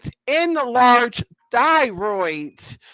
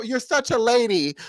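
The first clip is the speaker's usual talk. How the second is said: ordinary talk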